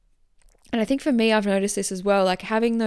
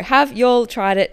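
louder, higher, slow American twang; imitated American accent; 5 semitones higher, 8 dB louder, slower than usual. Host rereading listener's question, mock American accent. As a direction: playfully draw it out in an American accent. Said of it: "is so American".

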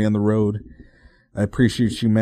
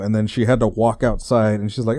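normal talking voice

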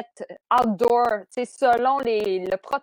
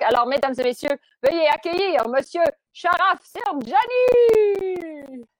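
announcer introduction; 6 semitones higher, 4 dB louder than usual. Real, not acted. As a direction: welcome the guest like a stage act. Host introduces guest on air.

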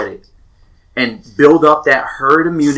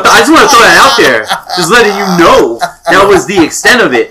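laughing while talking; 7 semitones higher, 7 dB louder than usual. Real, not acted. Joking to friends about being too sick to game, laughing. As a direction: talk through a laugh, higher and louder.

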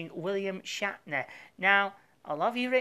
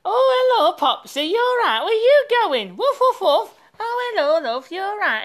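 talking to the dog; 13 semitones higher, 12 dB louder than usual. Real, not acted. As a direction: coo at the dog in a high sing-song. Host greets the family dog.

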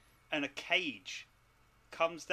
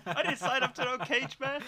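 laughing protest; 10 semitones higher, 4 dB louder than usual. Acted.

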